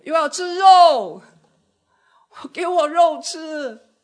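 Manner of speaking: high, loud, slow whining demand; demanding crowd. Retold or imitated. Preacher voicing Israelites demanding meat, high and loud.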